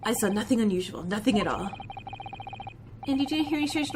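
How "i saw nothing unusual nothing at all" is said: put-on a proud Russian crewman, heavy accent; Russian seaman introducing himself, low and gruff; Stroganoff, Russian accent.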